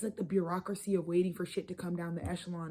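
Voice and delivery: usual speaking voice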